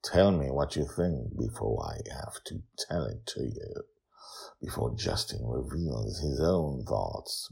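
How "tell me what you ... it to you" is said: ordinary delivery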